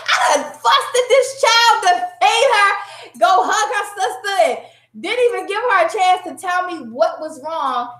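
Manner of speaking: high-pitched lament at yourself, settling gradually; exasperated self-reproach. Retold or imitated. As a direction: wail over your own blunder. Acts out regret at unfair scolding.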